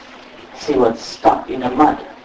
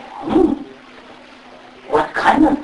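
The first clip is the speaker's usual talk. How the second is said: high unvoiced wondering tiger; a wary tiger murmuring to himself; puzzled tiger; 13 semitones higher, slower than usual. Tiger puzzling over Mister Bob in a high breathy murmur. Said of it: "tiger said".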